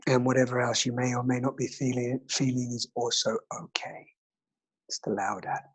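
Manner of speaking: soft whispered meditation; whispering. Acted. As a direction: breathe the words gently.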